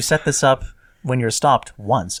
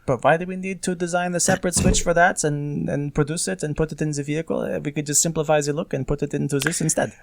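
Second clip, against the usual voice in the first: mock German engineer voice; playful German accent, higher pitch; 4 semitones higher than usual. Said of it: "is that a Swedish accent?".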